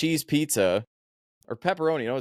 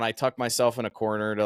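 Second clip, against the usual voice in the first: ordinary delivery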